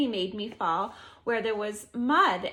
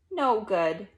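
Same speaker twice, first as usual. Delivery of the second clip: usual speaking voice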